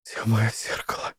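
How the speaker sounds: breathy unvoiced whisper. A narrator's reading. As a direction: whisper it searching. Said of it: "шепчет она".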